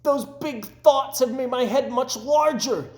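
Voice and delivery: high, loud and thrilled; delighted. A narrator's reading. Toad telling Frog his hat fits, delighted. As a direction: burst with joy at the news.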